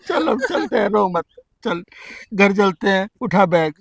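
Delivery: gentle coaxing. Acted. Husband softly coaxing wife to come home, tender. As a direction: coax her home gently, almost murmuring.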